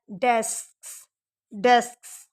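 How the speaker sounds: डेस्क्स with a clear final s; डेस्क्स, each consonant marked; s ending. Shown as a demonstration. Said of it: "तो वो भी क्या बनता है?". (डेस्क्स का अंत साफ़)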